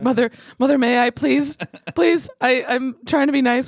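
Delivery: high, loud begging voice; pleading customer. Retold or imitated. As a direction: beg her sweetly for a drink. Imitating Jason pleading.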